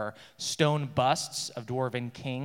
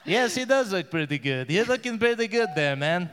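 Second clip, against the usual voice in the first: character voice; 4 semitones higher than usual. Acted. NPC in character.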